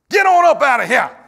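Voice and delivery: loud, high, emphatic Texan shout; Texas-style holler. Acted. Preacher showing how they say go in Texas, emphatic holler. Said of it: "as they say in Texas".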